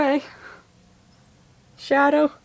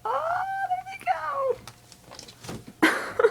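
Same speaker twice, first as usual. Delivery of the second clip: delighted; 3 dB quieter than usual. Real, not acted. Watching birds leave.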